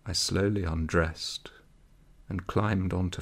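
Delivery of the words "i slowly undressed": plain reading voice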